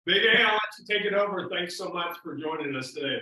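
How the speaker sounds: loud handoff. Real, not acted. Host handing the mic to Big Al, loud and fast. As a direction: hand the floor over with a big loud intro.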